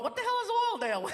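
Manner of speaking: mock clueless viewer; baffled viewer. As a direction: ask like a confused outsider. Viewer at home asking.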